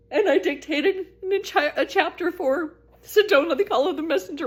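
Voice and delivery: high, emotional voice with halting pauses; choked up. Real, not acted. Telling listeners of a message from God, choked up.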